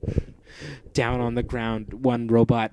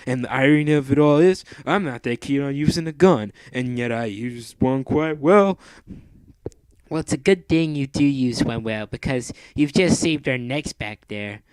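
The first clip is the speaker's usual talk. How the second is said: a higher put-on accent; character voice; 4 semitones higher, 5 dB louder than usual. Wilson and Mandy chatting after the fight, higher put-on accent. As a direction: talk it through in character.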